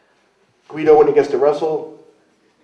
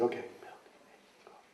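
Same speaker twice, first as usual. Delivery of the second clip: softer, breathy list under the breath; mumbled repeating; 21 dB quieter than usual. Retold or imitated. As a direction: mutter the list back to himself. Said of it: "I said".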